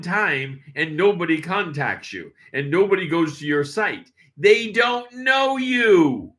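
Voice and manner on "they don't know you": slow, loud, high-pitched emphasis; emphatic shout. Real, not acted. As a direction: drive the point home, slowly. Emphatic point to listeners.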